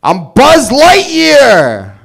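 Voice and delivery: very slow, high, loud New York drawl; New York Buzz Lightyear. Retold or imitated. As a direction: stretch it out like a Brooklyn toy hero. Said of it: "Toy Story if it was in New York".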